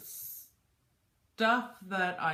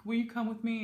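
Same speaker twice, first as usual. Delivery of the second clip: emotional pleading; 10 semitones higher than usual. Retold or imitated. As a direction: beg like you're about to fall apart. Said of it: "'cause he knows that I'm emotional".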